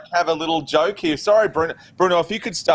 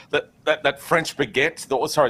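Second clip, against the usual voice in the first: ordinary talk, nothing marked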